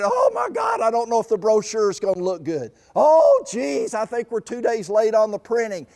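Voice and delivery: loud, high panicked fretting; mock panic over a brochure. Campaign leaders fretting over brochure and printing, mock panicked and loud. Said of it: "This is where I see people fret".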